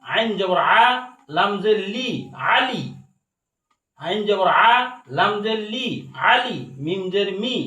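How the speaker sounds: rhythmic Quran-class recitation drill; teacher chanting syllables for learners to copy; spelling drill. Shown as a demonstration. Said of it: "একবার বানান তিনবার উচ্চারণ করে".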